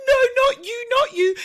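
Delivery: put-on high, loud little-boy wail; high loud toddler rejecting his mother. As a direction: shriek at mum like a toddler.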